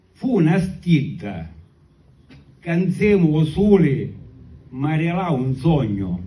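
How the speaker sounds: slow declaimed poem in Sicilian; sicilian accent. Acted. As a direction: recite the verses slowly in Sicilian. Recites his Sicilian poem.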